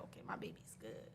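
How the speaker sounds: a soft private whisper; whispered relief. Retold or imitated. Relieved inner thought, whispered.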